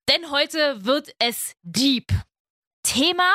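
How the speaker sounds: slow, weighty teaser line; slow dramatic tease. Acted. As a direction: drag it out for drama. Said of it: "dramatic teaser".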